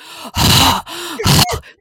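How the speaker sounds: big high gasp. Retold or imitated. Imitating cohost Erin's loud gasping, big gasp. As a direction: suck in a loud sharp gasp. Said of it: "she's like".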